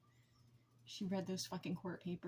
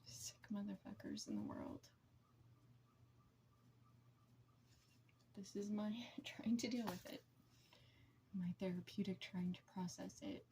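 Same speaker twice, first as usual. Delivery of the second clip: hushed, breathy whisper, much softer; barely voiced, words falling to a whisper; 3 semitones lower, 8 dB quieter than usual. Real, not acted. Venting alone about her abusers, in a whisper.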